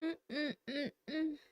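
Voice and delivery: yummy hum. Real, not acted. Tasting food.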